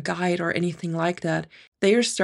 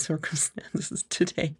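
normal talking voice

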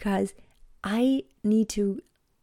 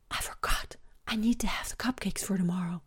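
panicked little whisper; 5 dB quieter than usual. Retold or imitated. Daughter at the bedroom door at night, anxious whisper. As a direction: whisper the confession so the babies stay asleep.

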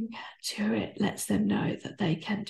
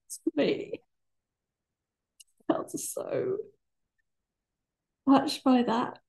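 choked up while speaking, really moved; crying; 5 semitones higher, slower than usual. Real, not acted. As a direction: voice breaking with tears, pitch up. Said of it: "So I'm crying".

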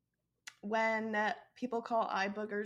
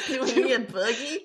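louder, laughing, hammed-up Southern accent on "buggy"; mock country accent; 8 dB louder than usual. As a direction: overplay the Southern country accent of the first take. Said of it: "I just get a really hard country accent".

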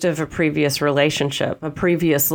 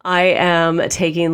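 ordinary delivery

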